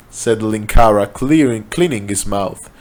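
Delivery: plain reading voice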